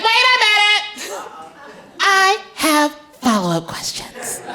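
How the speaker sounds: put-on high, slow child's voice; a kid not letting it go; inquisitive little girl. Daughter pressing mom, high and insistent.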